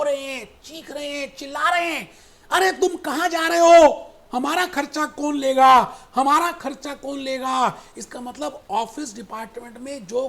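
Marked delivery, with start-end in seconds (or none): 2.5-7.8 loud playful yelling of office staff; mock shouting. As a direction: shout it out like a desperate clerk. Office staff voiced crying out.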